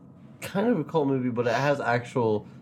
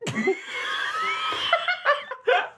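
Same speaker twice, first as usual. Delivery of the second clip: high creaking squeal; squeaky door creak. Pretend. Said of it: "You do one, you do one".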